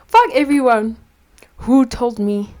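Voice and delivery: angry shout. Real, not acted. Venting at people who recommended the film, furious shout. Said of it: "who suggested that I should watch".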